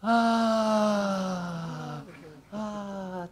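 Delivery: demonstrating deep exhale; breath demo. Shown as a demonstration. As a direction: model a big sighing breath. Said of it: "take a deep breath and go like this".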